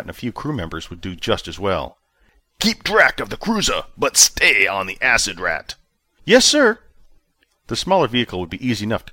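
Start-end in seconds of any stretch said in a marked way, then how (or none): 2.6-5.7 clipped commanding officer tone; hint of a stern commander demanding answers; commander Justice, lightly voiced. A narrator's reading. Commander gives orders. 6.2-6.8 put-on much higher, louder young officer voice; officer answering his commander, high and eager; nervous junior officer. A narrator's reading.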